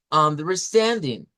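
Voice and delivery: Understanding a bit slow, stand lifted; stress on stand. Shown as a demonstration. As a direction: up on stand then down on ding. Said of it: "Y eso es hablar con tono".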